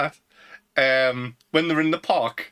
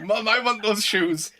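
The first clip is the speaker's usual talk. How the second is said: put-on slow, higher, whining child; whiny kid; 6 semitones higher than usual. A kid begging his mum for shoes, slow and whiny.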